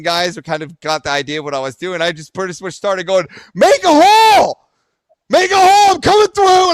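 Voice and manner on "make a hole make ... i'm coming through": high, very loud holler; re-enacted urgent yelling; crowd-clearing shout. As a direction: bellow to clear a path through people. Shouting to push through a crowd.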